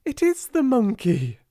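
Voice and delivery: high excited cry; a girl's thrilled cry; cried out. A narrator's reading. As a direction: gasp it out with delight. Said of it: "she cried out".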